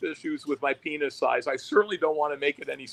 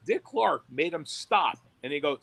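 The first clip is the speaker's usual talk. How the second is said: ordinary talk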